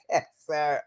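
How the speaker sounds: ordinary delivery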